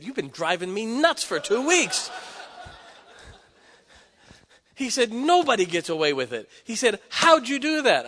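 loud, high, half-laughing disbelief; an outwitted pastor throwing up his hands; amused exasperation. Retold or imitated.

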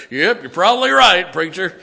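joking farmer. Retold or imitated. Retold farmer Bill joking back.

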